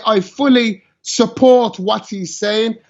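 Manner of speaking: slow, deliberate, every word spaced out; slow and emphatic, I fully support; said slowly. Shown as a demonstration. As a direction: spell it out slowly so nobody mishears. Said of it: "let me say it slowly".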